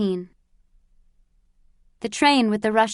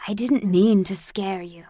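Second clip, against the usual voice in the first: put-on gentle old man voice. A narrator's reading. Old man reassures frightened girl.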